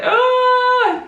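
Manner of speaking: a high, loud wail as the tears break; retold burst of tears. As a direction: let out a sudden tearful cry, high and loud. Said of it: "I was like".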